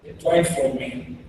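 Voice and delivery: normal talking voice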